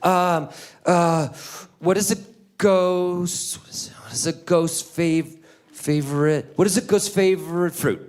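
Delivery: put-on high, halting little-kid voice; stammering child. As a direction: stumble through it like a nervous three-year-old. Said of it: "he starts stammering".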